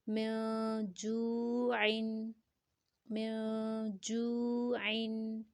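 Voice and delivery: "میں" hummed long, then "جو" stretched very slowly; elongated recitation. Shown as a demonstration. Teacher joins the word after explaining madd, elongated recitation. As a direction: hold both "میں" and "جو" on a level tone. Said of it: "جو کو ہم نے اس لیے لمبا کیا ہے".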